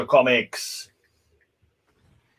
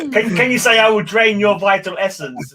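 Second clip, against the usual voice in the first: imitating a Skeksis; 6 semitones higher, 4 dB louder than usual. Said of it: "like one of the Skeksis".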